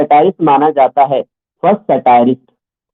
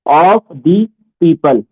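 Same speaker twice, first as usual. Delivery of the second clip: "of the people" word by word; slow dictation; 2 semitones lower, much slower than usual. Real, not acted. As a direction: say "of the people" slowly, a gap after each word. Teacher repeats slowly for note-taking. ("of the people" धीरे-धीरे, एक-एक शब्द लिखवाते हुए)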